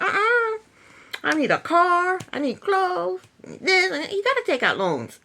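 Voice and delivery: high, insistent mock whine; mock whiny James. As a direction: list the wants like a spoiled kid. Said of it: "James was like".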